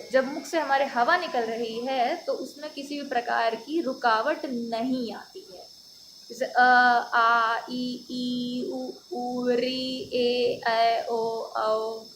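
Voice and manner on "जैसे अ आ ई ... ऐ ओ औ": slow, drawn-out Hindi vowels; teacher reading out the vowels; vowel recital. Shown as a demonstration. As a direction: recite each vowel slowly and openly. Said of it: "ये जब हम बोल रहे हैं".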